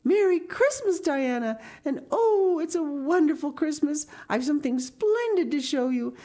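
put-on high, bubbling girl voice; jubilant Anne. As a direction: burst out with thrilling news for a best friend. Voices Anne greeting her friend.

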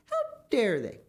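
scandalised outburst; indignant cry. Acted. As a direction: exclaim it, thoroughly affronted. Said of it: "cried Missus Gregg".

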